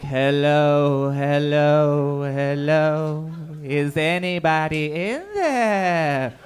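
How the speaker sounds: long, loud, drawn-out call; low sustained echoing holler. Acted. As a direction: call into a cavern, holding each word. Calling into an imagined echo.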